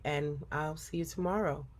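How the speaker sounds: ordinary delivery, nothing marked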